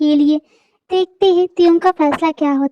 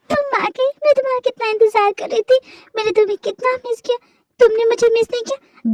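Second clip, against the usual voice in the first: put-on high, eager girlish voice; gushing over her returned husband, pitched high; clingy young wife; 6 semitones higher, faster than usual. Wife to her husband, high and gushing.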